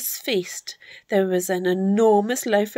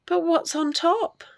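high, wondering question; young Jack puzzling aloud; curious boy; 8 semitones higher than usual. A narrator's reading. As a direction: ask in high-voiced curiosity.

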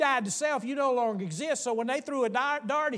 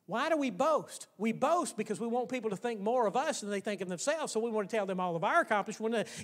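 usual speaking voice

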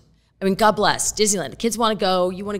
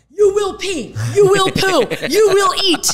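high, loud drill-sergeant orders, half laughing; mock stern command; 11 semitones higher, 6 dB louder than usual. Mom laying down the law to her kids in the bathroom, loud mock command.

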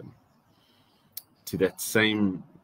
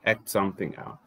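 normal talking voice

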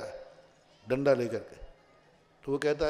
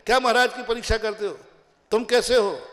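quick, higher-pitched mock challenge; challenging; 5 semitones higher, 5 dB louder than usual. People questioning who examines the monk, challenging. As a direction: fire it off as an accusing question.

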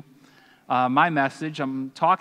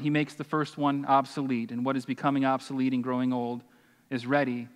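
ordinary delivery

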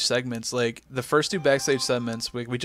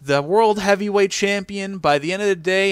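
mock loud boast; 7 semitones higher, 6 dB louder than usual. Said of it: "He goes".

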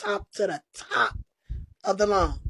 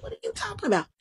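baffled disbelief; 13 semitones higher, 3 dB quieter, much faster than usual. Retold or imitated. Her own baffled reaction.

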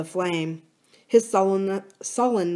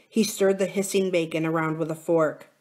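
ordinary delivery, nothing marked

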